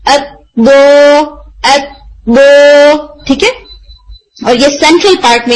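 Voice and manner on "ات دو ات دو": slow, heavy دو hit hard; heavy strong letter demo. Shown as a demonstration. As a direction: push the sound from the throat. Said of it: "heavy اور بہت strong sound آتی ہے".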